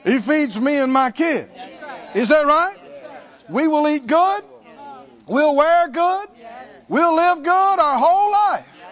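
loud, high, rousing proclamation; confident faith declaration. Acted. Parent declaring God will provide for kids, bold loud declaration. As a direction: proclaim it boldly to the whole congregation.

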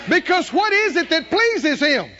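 high, loud preaching call; full-force preaching. Real, not acted. Preacher calling the congregation to answer, high loud preaching. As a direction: build it to a peak.

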